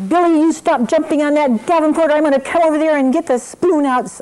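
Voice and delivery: loud, high-pitched scolding threat; angry parent. Retold or imitated. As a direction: yell at a misbehaving child, fed up. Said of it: "I'm getting really angry".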